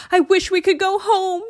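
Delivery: high, loud desperate wish; shouting. A narrator's reading. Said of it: "he shouted".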